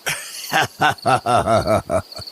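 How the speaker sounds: long, rapid string of ha-ha laughs; drawn-out amused cackle; scoffing laugh. Acted. Elder laughs at the monster claim.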